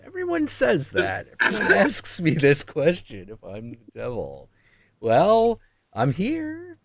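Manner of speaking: a high, breezy devil brushing it off; chummy devil, amused at the question. Retold or imitated. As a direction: play the devil high and casual. Devil character answers.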